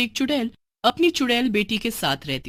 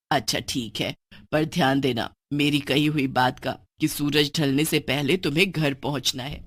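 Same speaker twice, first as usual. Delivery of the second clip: witch mother warning; 5 semitones lower than usual. Acted. Mother reminding daughter of sunset rule, low stern voice. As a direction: warn her gently.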